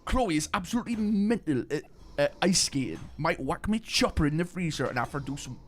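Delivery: high-pitched put-on Geordie; Geordie Shore accent. Recalling a line from Geordie Shore, hammed-up Geordie accent. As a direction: quote reality TV in an exaggerated Geordie accent. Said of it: "what is it from Geordie Shore".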